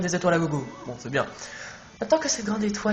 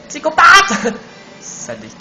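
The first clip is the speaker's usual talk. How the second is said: sudden high yell at the boss; shouted insult; 9 semitones higher, 9 dB louder, slower than usual. Real, not acted. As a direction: yell it out in outrage. Cri au boss dans le jeu. (crié avec indignation)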